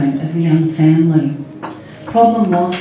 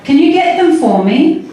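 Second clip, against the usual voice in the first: higher-pitched, carefully worded question; clear, child-directed speech; 10 semitones higher, 4 dB louder than usual. A narrator's reading. Sally to little Chrissy on the phone, clear and careful. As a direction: ask it clearly, as if to someone hard of hearing. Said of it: "made her words slow and clear".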